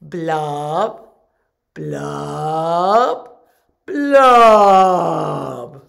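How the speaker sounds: gurgling fish man croak. Shown as a demonstration. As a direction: bubble it out, building loud. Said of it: "Help me out".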